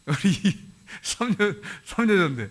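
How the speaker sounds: breaking into laughter while speaking; laughing. Real, not acted. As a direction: chuckle through the words, halting. Teasing congregation about a past election. (웃음을 터뜨리며 말하기)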